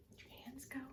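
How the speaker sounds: normal talking voice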